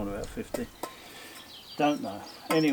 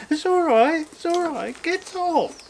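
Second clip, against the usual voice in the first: much higher, coaxing coo to the cat; high mum voice; 12 semitones higher, slower than usual. Pretend. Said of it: "I should be talking really high pitched".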